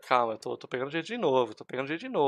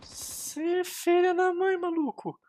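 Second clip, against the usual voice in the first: very high, drawn-out whine; slow squealed curse; frustrated squeal; much slower than usual. Real, not acted. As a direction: squeeze it out slowly, high-pitched. Killed from behind.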